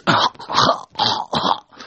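imitated choking gags; short, voiceless gagging coughs. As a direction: mimic someone gagging. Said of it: "She gags a little bit".

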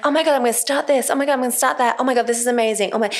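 high, fast run of breathless-keen enthusiasm; mock gushing excitement. Creatives announcing new projects to her, mock gushing excitement. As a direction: gush quickly, imitating an over-keen creative.

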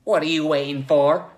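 loud, high impatient demand. Acted. Joe hurries the hesitating Logue, impatient. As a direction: demand it loudly, fed up waiting. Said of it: "Joe asked impatiently".

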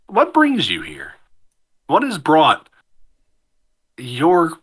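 slow, halting question with long pauses; voiced tavern strangers. Two strangers question the newcomer at the table, slow and measured. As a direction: weigh each phrase before saying it. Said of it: "and say".

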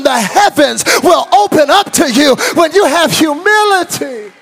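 loud, high, driving sermon delivery; full-force preaching. Real, not acted. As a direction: preach it at the top of your voice.

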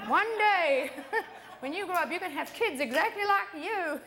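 mock dad wish, high and laughing. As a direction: giggle through dad's old warning. Dad's wish retold, laughing.

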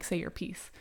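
usual speaking voice

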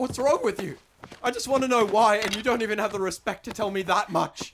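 high, loud, furious; angry shouting. Acted. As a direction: yell it at her. Quarrel turning violent.

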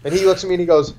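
ordinary talk, nothing marked

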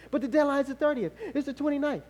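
high, pressed fretting, acted; anxious protest. Believer pleading the date with God, mock-anxious. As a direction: argue back in mock panic.

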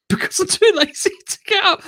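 high voice breaking into laughter; real laughing. Real, not acted. Podcaster owning up to laziness, laughing. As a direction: squeal it out through a laugh.

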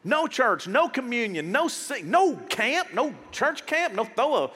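ordinary delivery

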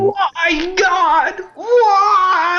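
high, drawn-out theatrical wail; hammed-up despairing cry; mock anguished wail. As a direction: cry out to the heavens in fake despair. Mock wail to God over pizza.

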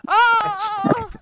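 high agonized scream; burning scream. Pretend. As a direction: scream as if set on fire. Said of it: "to scream in agony".

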